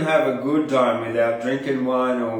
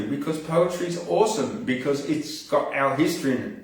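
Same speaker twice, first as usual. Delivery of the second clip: ordinary delivery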